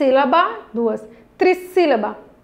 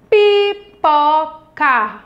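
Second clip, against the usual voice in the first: very slow, stretched syllables; 4 dB louder, much slower than usual. Shown as a demonstration. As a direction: break the word into three drawn-out pieces. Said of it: "três pedacinhos também".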